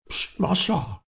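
hissed psst, then high-pitched call; secretive call from hiding. A narrator's reading. Bob calls his master from the hollow tree, high covert call. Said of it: "dass der Laut von oben kam".

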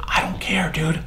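breathy unvoiced whisper; real whisper of I don't care, dude. Real, not acted. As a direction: whisper it like a secret.

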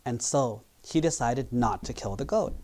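ordinary talk, nothing marked

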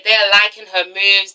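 usual speaking voice

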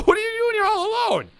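high, loud incredulous outburst; shocked questioning. Acted. Player startled by someone in the game, shocked questioning.

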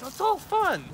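very high put-on voice; comically high delivery; high squeaky voice. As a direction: pitch it way up, far from your own voice. Acts it high, then plain.

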